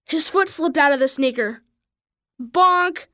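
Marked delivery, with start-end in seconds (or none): 2.4-3.1 higher-pitched cartoon impact word; mild yell. A narrator's reading. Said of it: "he heard Mitchell yell".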